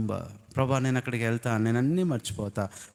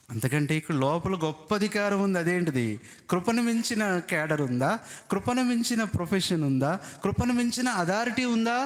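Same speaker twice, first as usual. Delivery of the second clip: climbing higher with each question; building preaching; 9 semitones higher than usual. Acted. Pastor asking the church about grace, climbing fervently. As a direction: drive the question home to the church.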